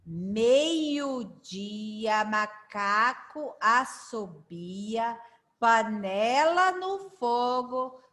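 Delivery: slow, measured rhyme reading; leitura bem pausada. Shown as a demonstration. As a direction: read line by line so learners can follow. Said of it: "Eu vou ler bem pausado". (lendo a parlenda pausadamente)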